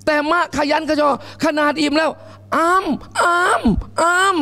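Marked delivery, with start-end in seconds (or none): 2.3-4.4 put-on very slow, high baby munching noises; mock baby eating sounds. Preacher mimics a baby eating, slow and high. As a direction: play a baby chomping at its food.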